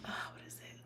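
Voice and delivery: whispered to herself. Real, not acted. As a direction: whisper it to yourself, searching. Searching memory for a remedy.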